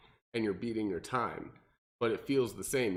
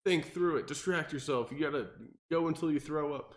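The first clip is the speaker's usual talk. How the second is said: mock pep talk, pitched up and insistent; gung-ho crowd; 6 semitones higher than usual. Coach mimics everyone pushing hard workouts, mock gung-ho. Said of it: "everyone's like".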